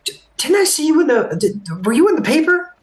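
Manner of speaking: high, eager gushing voice; starstruck villager. Acted. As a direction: gush like an awed local meeting a celebrity. NPC excited about player's story.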